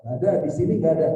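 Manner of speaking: fast, loud, playful "nggak ada"; mock denial. Preacher mimicking women denying they are judes, fast and loud. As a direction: rattle it off like a crowd of defensive women.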